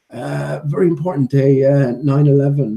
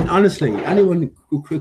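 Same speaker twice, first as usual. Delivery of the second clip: ordinary talk, nothing marked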